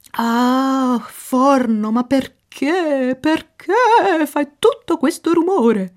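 put-on high, loud Italian-accented lady complaining; a migraine-plagued lady fretting over noise; fussy Mademoiselle Fenster. Mademoiselle Fenster scolds the noisy oven, high fretful voice.

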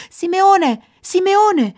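searching shout, falling at the end; calling out. A narrator's reading. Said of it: "rief er".

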